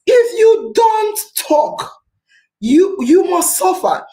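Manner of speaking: slow and emphatic, every word weighted; slow deliberate warning, each word stressed; loud and clear. Real, not acted. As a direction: drive it home slowly. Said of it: "I want to say it loud and clear".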